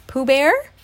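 gasping Piglet. A narrator's reading. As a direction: ask it in startled worry, rising. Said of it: "Piglet gasped".

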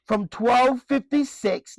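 ordinary delivery

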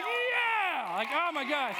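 whooping, very high-pitched cheer; acted elation. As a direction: cheer like a thrilled dad. Reliving joy at son's conversion.

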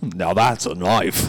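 drawn-out Australian movie catchphrase; Australian accent. Acted. Guest quoting a canned phrase, slow mock Australian accent. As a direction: stretch it in a broad Australian accent. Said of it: "There you go, dude".

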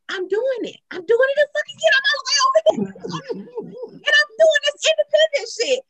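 high-pitched exasperated retelling; exasperated self-mockery. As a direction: wail it high, fed up with yourself. Retelling her stubborn refusal of help.

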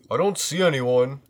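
put-on teen boy voice. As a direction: peer in like a teen. Voicing Jason.

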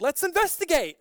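high-pitched, eager mock movie character; movie hero. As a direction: pitch it up like a clueless film hero.

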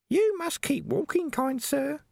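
very high, slow, sugary threat; shrill old aunt. A narrator's reading. Agony aunt prodding Vimes onward, very high and mock sweet. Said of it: "said a voice".

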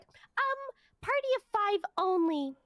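high, snooty voice mocking her; mocking rude worker. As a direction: sneer it like a dismissive clerk.

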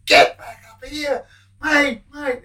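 high, loud bellow up the cell block; calling out in a high, loud voice; mock prisoner's yell. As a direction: yell it down to the men at the back gate. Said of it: "he's yelling out".

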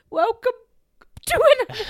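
loud, high mock choke; mock choking. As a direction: ham up the choked intro. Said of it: "going like".

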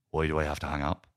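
subdued questioning reply in own register; understated Ray. Acted. Man on the phone echoing his upset girlfriend, subdued question. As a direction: echo the question back evenly. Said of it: "And Ray goes".

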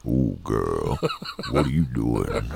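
deep voice from canned air. Shown as a demonstration. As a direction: sink into a cartoonish bass voice. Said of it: "your voice straight up".

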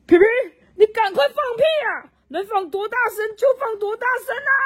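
拔高声音大喊; 爸爸急着朝天上喊主意; shouting. A narrator's reading. 青蛙爸爸对天上喊. (爸爸朝天上大喊放屁)

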